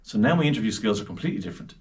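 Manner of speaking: usual speaking voice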